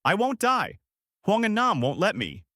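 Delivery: high, defiant shout; mild shout. A narrator's reading. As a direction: call back high with restrained volume. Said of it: "Lan heard him shouting".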